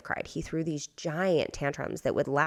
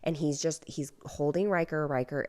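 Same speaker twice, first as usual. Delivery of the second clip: ordinary talk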